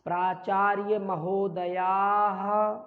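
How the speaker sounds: "प्राचार्य महोदयाः" very slow, long vowels; pronunciation demo. Shown as a demonstration. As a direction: draw out "महोदयाः" to a clear final "ha". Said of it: "हा का उच्चारण".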